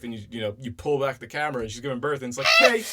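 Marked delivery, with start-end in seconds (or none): 2.6-2.8 loud, high, fed-up "you couldn't see that"; exasperated. Retold or imitated. Fan scolding Kay for missing the birth, exasperated. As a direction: call him out in disbelief. Mock complaint at the character Kay.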